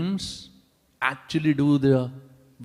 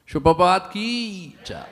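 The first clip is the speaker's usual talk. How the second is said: devotional call; 6 semitones higher than usual. Real, not acted. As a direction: shout it out for all to answer. Preacher calls out devotional chant.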